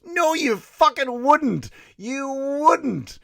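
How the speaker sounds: high, loud, slow scoffing no; incredulous shout. Real, not acted. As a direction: yell the answer back at them, drawn out. Emphatic retort to listeners.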